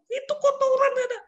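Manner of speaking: very high, loud pointing scold; exasperated boss; angry boss. Retold or imitated. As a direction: jab at the dirt in anger.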